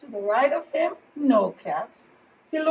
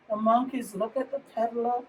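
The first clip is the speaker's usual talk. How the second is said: plain reading voice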